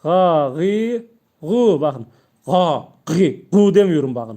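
light throat push; pressed ga gi gu. Shown as a demonstration. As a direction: press gently on ga gi gu. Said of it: "Hafiften bastırıyorum bak".

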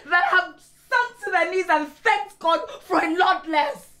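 loud, high, indignant scolding; angry outburst. Acted. Wife scolding proud husband over refused help, angry and loud. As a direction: raise your voice and berate him.

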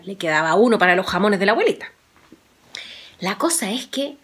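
ordinary delivery, nothing marked

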